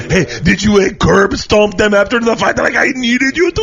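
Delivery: high, loud goofy vampire voice; Adam Sandler vampire. Pretend. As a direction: ham up a silly vampire accent. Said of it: "Adam Sandler vampire".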